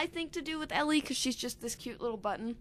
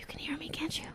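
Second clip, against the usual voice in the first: soft breathy whisper to the listener; giggly prank whisper; 6 semitones higher, 6 dB quieter than usual. Real, not acted. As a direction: whisper it, teasing.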